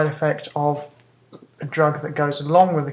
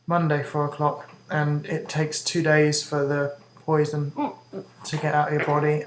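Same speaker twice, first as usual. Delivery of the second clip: normal talking voice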